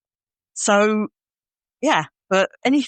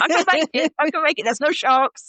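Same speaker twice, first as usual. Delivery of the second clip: higher-pitched, mock pep talk to oneself; eager self-encouragement; 5 semitones higher than usual. Host acting out riding the wave with no sharks, mock eager self-encouragement. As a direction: play at psyching yourself up, pitched up.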